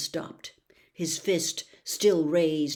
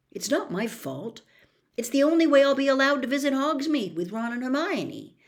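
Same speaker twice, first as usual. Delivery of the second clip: higher and faster, pleading his case; defensive Harry; 8 semitones higher, 5 dB louder than usual. Acted. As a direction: justify yourself to the owl, rushing. Harry explaining to owl.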